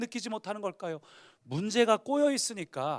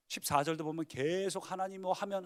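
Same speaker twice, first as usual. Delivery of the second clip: ordinary talk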